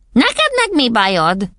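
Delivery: high, drawn-out mother's voice; puzzled mother. A narrator's reading. Mother waking her daughter on the bed, puzzled. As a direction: demand an explanation in a high voice. Mother wakes sleeping Matilda.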